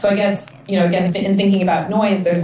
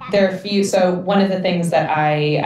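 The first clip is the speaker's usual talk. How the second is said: ordinary delivery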